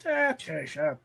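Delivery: lightly voiced cold hag; very old woman.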